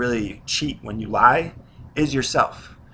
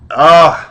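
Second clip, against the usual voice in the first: loud, higher-pitched groan of disgust; exasperated groan; 5 semitones higher, 16 dB louder than usual. Real, not acted. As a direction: let out a big, loud "ugh" of exasperation. Exasperated at friends who put off starting.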